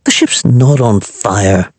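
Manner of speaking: slow, flat annoyed correction; unimpressed, spell it out slowly; mild irritated kitchen boy. A narrator's reading. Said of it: "came Laddie's annoyed voice".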